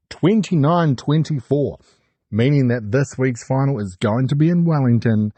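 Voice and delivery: ordinary delivery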